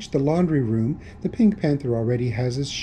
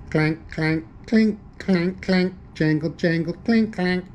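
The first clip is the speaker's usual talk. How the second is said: higher, chanted metallic clanks; voiced clanking noises; 5 semitones higher than usual. A narrator's reading. Narrator imitating a noise from the attic, clanking. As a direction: sound out each clank and jangle separately. Rattling chains heard from attic.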